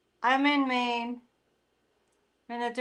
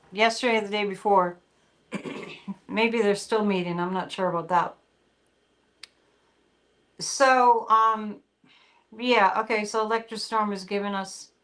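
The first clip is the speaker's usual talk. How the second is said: ordinary talk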